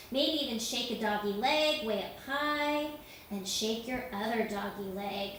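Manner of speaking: usual speaking voice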